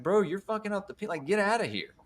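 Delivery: high, rapid mock protest; acted-out exasperated dismissal; indignant telling-off. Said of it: "He's like".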